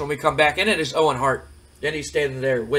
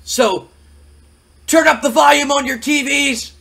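loud, high-pitched wrestler's tirade; fired-up wrestling promo; 11 semitones higher, 6 dB louder than usual. Acted. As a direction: declare your title claim at full volume, pitched way up. Continues the loud acted wrestler promo.

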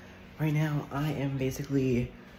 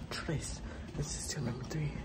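hushed whisper of number tres; 8 dB quieter than usual. Real, not acted. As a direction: whisper it secretly. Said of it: "whispering on camera".

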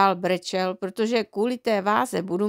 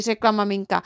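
ordinary delivery

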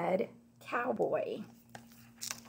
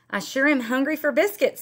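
hungry rancher; 6 semitones higher, 6 dB louder, faster than usual. Acted. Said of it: "said the rancher".

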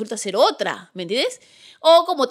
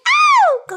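sudden high yelp of delight; excited squeal; 6 dB louder than usual. Real, not acted. Squealing at a friend's shout-out to Mari. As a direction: let out a shrill little squeal.

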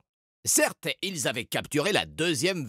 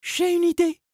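put-on girl's voice; 6 dB louder than usual. A narrator's reading. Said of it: "finit-elle par dire".

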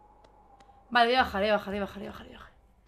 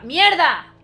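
loud, raised cry of dismay; frustrated curse; 4 semitones higher, 13 dB louder than usual. Real, not acted. Gamer mid-chat hit by mishap, frustrated shout. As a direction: yell the swear word as things go wrong.

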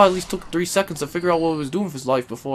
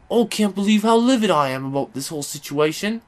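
ordinary talk, nothing marked

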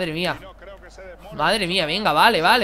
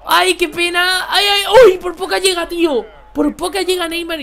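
laughing high squeal, much louder; high-pitched excited wail, much louder; excited near-miss cry; 10 semitones higher, 8 dB louder than usual. Real, not acted. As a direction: yelp with a laugh as the chance slips away.

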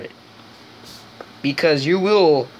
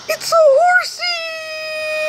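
very high squeak, stretched out slowly; slow, drawn-out high squeal; drawn-out squeaky horsey voice; 4 dB louder, slower than usual. Acted. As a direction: squeal it slowly like a delighted child. Excited at spotting a horse.

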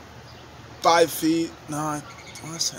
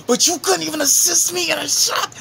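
mock-outraged, high-pitched complaint to a teammate; exasperated outburst; 13 semitones higher, 5 dB louder than usual. Scolding Junior over a missed assist, high exasperated outburst. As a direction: voice leaps up high and loud.

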